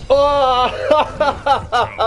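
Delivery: high laughing remark; laughing realization. Real, not acted. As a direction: laugh at the lag. Laughing at game lag. (смеясь, с пониманием)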